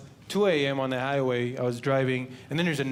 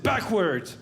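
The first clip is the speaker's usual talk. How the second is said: cop; 5 semitones higher than usual. Retold or imitated. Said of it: "the cop said".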